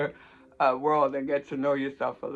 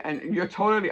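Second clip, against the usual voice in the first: ordinary delivery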